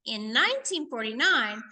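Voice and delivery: normal talking voice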